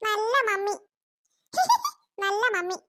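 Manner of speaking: put-on high child voice laughing with delight; a kid who just got their way, giggling; giggling happy kid. Kids happy mother agreed.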